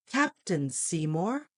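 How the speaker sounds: usual speaking voice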